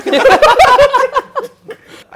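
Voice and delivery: loud laughter bursting out; big high laugh. Real, not acted. Actor cracking up over the co-actor's accent. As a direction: laugh hard at the punchline.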